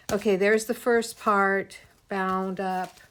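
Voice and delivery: normal talking voice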